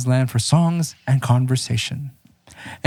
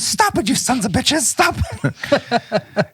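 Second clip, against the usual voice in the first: high-pitched officer shouting; 12 semitones higher, slower than usual. Acted. Officer yelling at troops.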